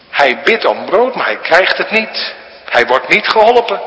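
fervent preaching. Acted. Preacher building fervour for the congregation, fervent and loud. Preacher rising to full force.

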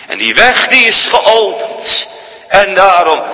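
preaching at full force; rousing preaching. Acted. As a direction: announce the open way with fervour. Preaching burst.

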